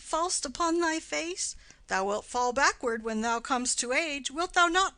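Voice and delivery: high, loud jovial husband teasing; husband's voice. Acted. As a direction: tease the fallen toddler merrily. Husband voiced third time.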